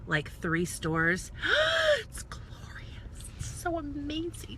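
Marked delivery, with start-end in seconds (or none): 1.4-1.9 sharp high gasp of delight. Real, not acted. Vlogger entering the new Apple Store with a high gasp. 2.0-4.6 slow hushed whisper of awe; awed whisper. Real, not acted. Vlogger awed by the reopened Apple Store, whispering.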